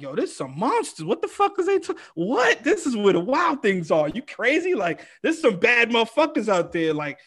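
high-pitched, swinging mock disbelief; retold amazement. Comic recalls first seeing LA comics, acted amazement. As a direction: act out being floored, voice jumping up.

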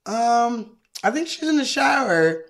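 high, innocent-sounding offer, climbing; needling neighbour. Acted. Neighbour offering to fetch showering wife, needling lilt. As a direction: lilt upward to wind him up.

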